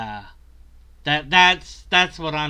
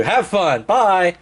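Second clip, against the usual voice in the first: ordinary talk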